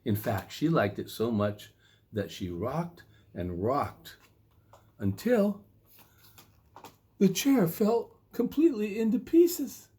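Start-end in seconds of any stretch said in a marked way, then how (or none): 5.0-9.9 higher and louder toward the crash; rising suspense to the crash; building excitement. A narrator's reading. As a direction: build it up until it breaks. Climax of rocking chair.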